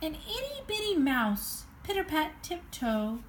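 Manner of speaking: usual speaking voice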